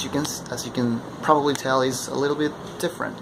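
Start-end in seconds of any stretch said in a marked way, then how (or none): none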